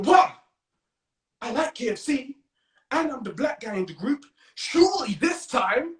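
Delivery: high-pitched, eager inner voice; hopeful excitement. Acted. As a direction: get thrilled at the chance to be included. Acting his hopeful younger thoughts.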